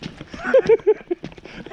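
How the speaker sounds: high-pitched burst of giggles; long high laugh; helpless cackle. Real, not acted. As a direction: crack up at your own predicament. Laughs at his own plight.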